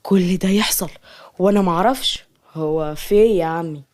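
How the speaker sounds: ordinary delivery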